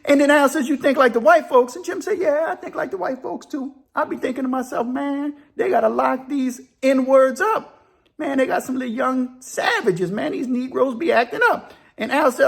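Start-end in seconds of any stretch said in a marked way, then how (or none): 0.7-1.8 high, loud disbelieving question; incredulous question. Retold or imitated. Al questions Jim's claim, incredulous. 2.2-11.7 high-pitched character voice, ranting along; animated prison buddy. Retold or imitated. Jim confesses his private thoughts to Al, high and animated.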